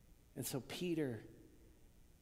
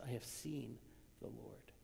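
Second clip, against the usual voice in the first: softer, fading to a whispered "the Lord"; hushed whisper; 9 dB quieter than usual. Retold or imitated. Mary telling the disciples, barely voiced. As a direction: let "I have seen the Lord" dissolve into a whisper. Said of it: "That's all she says".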